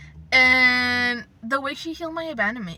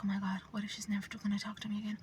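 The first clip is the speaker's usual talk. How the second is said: anxious whispered inner thought; hushed fearful what-if; scared whisper; 11 dB quieter, much faster than usual. Retold or imitated. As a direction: whisper it like a panicked worry. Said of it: "I was like".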